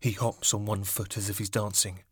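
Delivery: ordinary delivery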